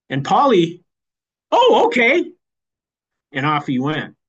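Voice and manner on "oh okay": high, loud mock compliance; instantly obedient husband. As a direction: imitate a husband caving at once. Husband gives in at once.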